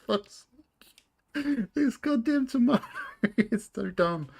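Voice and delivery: high, loud laughter breaking through the words; real helpless laughter at a silly tomato; laughing hard. Real, not acted. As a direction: howl with laughter while cursing.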